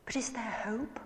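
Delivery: softly imitating a worried question; a quiet, anxious plea, lightly voiced. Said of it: "he said very anxiously".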